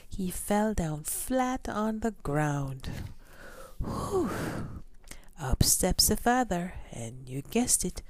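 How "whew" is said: exhausted whew. Acted. Giant exhausted.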